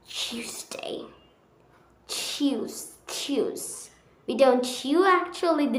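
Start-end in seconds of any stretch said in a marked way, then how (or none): 0.1-3.9 whispered Tuesday like choose-day; British accent. Shown as a demonstration. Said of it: "We say".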